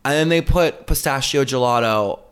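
ordinary delivery

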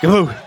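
mock impact sound; thud imitation. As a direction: smack it out like a blow. Imitating blows landing.

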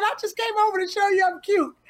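playful quote. Retold or imitated. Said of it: "You said".

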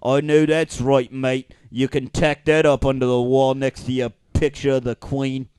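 broad Cockney mate; hammed-up London Cockney. Shown as a demonstration. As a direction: agree heartily in Cockney. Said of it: "London Cockney".

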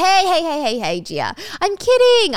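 mock-soothing, pitch swooping high; placating boyfriend. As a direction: backpedal with exaggerated "just kidding" lightness. Acted boyfriend placating his angry girlfriend.

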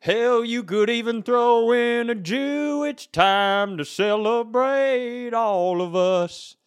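high twangy singer's pitch; sing-talk like a folksy country star. Acted. Skeet McGraw song persona pitch.

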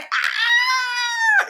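very high, loud excited scream; piercing happy shriek, acted out; reenacted squeal. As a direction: let out a squeal of overwhelmed joy. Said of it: "crying and squealing".

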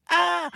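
high, sudden "ah!"; short high cry of a trapped spirit; spirit's yelp. A narrator's reading. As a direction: yelp high as you get stuck. Spirit kicks and sticks again.

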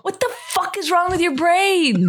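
high exasperated outburst; exasperated yell. Real, not acted.

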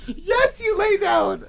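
high, shrieking laugh into the words; cry-laugh the answer way up high; helpless laughter. Real, not acted. Answering friend while crying with laughter, very high.

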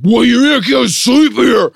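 high, loud, slow slurred bellow; drunken outrage. Retold or imitated. Drunk man protests being woken.

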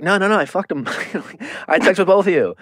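high, hammed-up blurting; mock blunt confession. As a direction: blurt it out like a clueless guy. Mocking Clayton's blunt admission.

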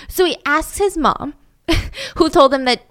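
usual speaking voice

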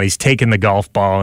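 normal talking voice

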